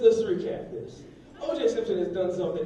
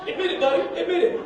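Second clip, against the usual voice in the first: teasing; 11 semitones higher, 6 dB louder, faster than usual. Real, not acted. Teasing a guy in crowd.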